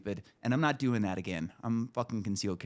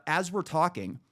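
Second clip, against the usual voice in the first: normal talking voice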